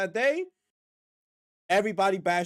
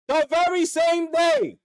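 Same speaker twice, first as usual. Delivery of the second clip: slow, high, loud build-up; hype-up shout, slow and high; dramatic loud emphasis; 7 semitones higher, 5 dB louder, slower than usual. Real, not acted. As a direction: shout it like a big reveal.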